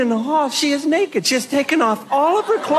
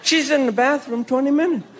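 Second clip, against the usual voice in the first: ordinary delivery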